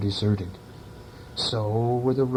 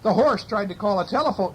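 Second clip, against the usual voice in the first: ordinary delivery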